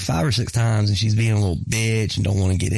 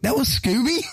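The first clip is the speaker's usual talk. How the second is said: high, incredulous question; mock disbelief; 14 semitones higher than usual. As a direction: play up the astonished double-take. Retells mom's disbelief at the barking.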